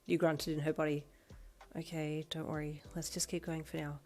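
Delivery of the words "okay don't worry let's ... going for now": softer, with quiet annoyance; soft and annoyed. Acted. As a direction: mutter it, a little irritated. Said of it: "you said softly with annoyance".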